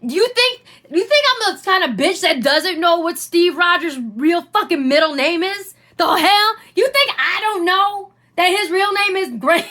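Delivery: outraged disbelief at the commenters; indignant disbelief. Real, not acted. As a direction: act offended they'd doubt you. Indignant rhetorical questions.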